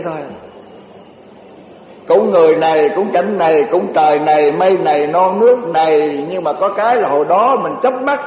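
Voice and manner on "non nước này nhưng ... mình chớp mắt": usual speaking voice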